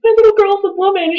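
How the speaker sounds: very high, mock-tearful gushing; emotional mom. Mom hearing the whole story, mock-tearful gushing. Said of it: "She started, like, literally crying".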